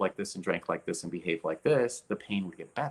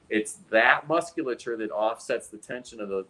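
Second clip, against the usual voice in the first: usual speaking voice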